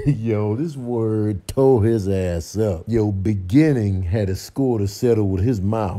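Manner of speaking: ordinary talk, nothing marked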